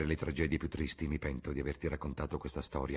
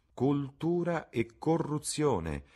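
very slow, higher, musing; slow echo; 10 semitones higher, much slower than usual. A narrator's reading. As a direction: echo the words slowly as if tasting them. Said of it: "gli fece eco Dorian".

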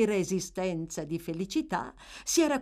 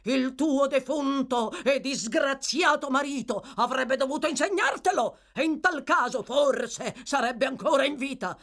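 taunting husband; 7 semitones higher than usual. A narrator's reading. Bumble taunting his wife.

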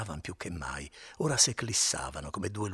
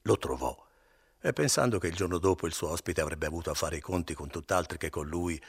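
ordinary delivery, nothing marked

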